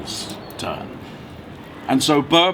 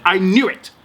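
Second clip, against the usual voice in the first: excited; 5 semitones higher, 5 dB louder than usual. Acted. King eagerly interrupts story.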